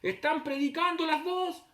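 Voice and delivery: high, slow announcement over a loudspeaker; imitated public-address shout; loudspeaker call. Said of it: "por harto parlante nos hablaban".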